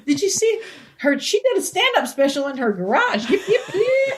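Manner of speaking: high laughing coyote voice; coyote gossip. Pretend.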